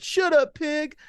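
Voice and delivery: very high, loud backtalk; defiant pretend kid. Prankster kid to fake cop, defiant. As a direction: yell it as a bratty kid at a cop.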